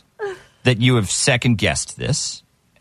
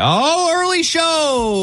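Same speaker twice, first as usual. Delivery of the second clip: drawn-out; much slower than usual. Acted. Drawn-out exaggerated answer.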